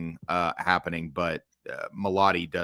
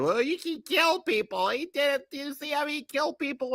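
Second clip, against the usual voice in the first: mocking Batfleck critics. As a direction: sneer at the critics by parroting them in a squeaky voice. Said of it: "People always bitching about Batfleck, saying".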